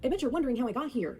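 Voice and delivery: high, quick mock narrator opening; imitated storyteller voice. Commentator mimicking how Alyssa talks, put-on narrator voice. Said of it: "kind of voice".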